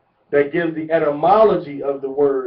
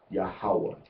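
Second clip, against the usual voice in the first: ordinary talk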